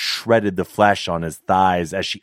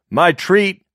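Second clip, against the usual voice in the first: much higher, louder chatty voice; eager flirting man; 12 semitones higher, 6 dB louder than usual.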